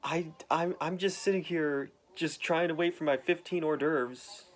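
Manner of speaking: high, mock-offended; indignant Kingpin. Kingpin complaining to Daredevil, high and offended. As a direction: act innocently put upon. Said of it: "Kingpin's like".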